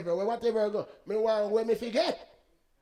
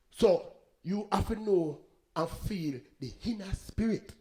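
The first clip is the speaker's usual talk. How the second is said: ordinary talk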